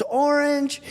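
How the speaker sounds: put-on high, small child's answer; a girl in the back answering the magician; little girl's voice. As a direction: chirp it like a little girl in class. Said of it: "this little girl in the back goes".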